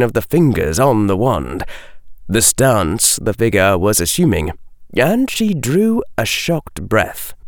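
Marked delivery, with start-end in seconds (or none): none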